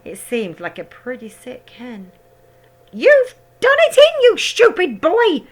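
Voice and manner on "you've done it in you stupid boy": put-on high, loud, slow old-woman scolding; shouting grandma. A narrator's reading. Grandma shouting down at George from the roof. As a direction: yell it at the boy like a cross old crone. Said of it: "Grandma shouted".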